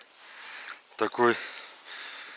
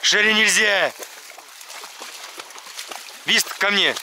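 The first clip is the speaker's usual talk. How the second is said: talking to a dog; 7 semitones higher, faster than usual. Real, not acted. Scolding and calling dogs.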